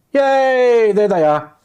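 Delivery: high, loud yay; excited cheer. Real, not acted. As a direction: cheer as the screens come on. Stream fixed, guests appear.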